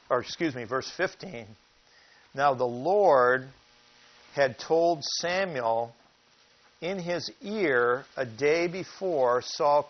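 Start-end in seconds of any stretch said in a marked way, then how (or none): 2.3-9.8 slow, weighty reading of scripture. Acted. Preacher reading the Samuel verse to the congregation, slow and weighty. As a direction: lay the verse out word by word. Reads scripture aloud slowly.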